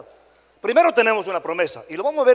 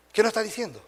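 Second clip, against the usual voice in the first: ordinary talk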